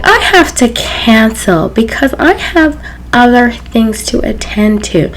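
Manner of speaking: slow, condescending grand lady; mock a lofty star, words deliberately drawn out; Meryl Streep. Star cancelling her booking with restaurant staff, condescending.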